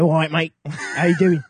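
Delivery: matey mock Cockney; Cockney accent. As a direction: play a Cockney parrot greeting a mate. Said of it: "the bird's talking like this".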